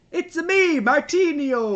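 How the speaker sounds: very high, loud cartoon Italian; Italian accent. Shown as a demonstration. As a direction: show off a hammed-up Italian accent, stretching the ending. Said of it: "That was my Italian accent".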